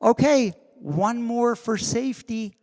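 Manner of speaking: high, bright, cheerful request; mock chipper crew member asking for another take; chirpy assistant. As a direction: sing it out like a clueless assistant. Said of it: "the production assistant said".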